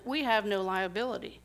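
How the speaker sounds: normal talking voice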